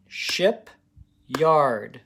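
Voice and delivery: shipyard split into ship and yard on claps; clapped syllables. Shown as a demonstration. Teacher clapping syllables of shipyard for kids. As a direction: clap out shipyard beat by beat. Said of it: "First I clap it".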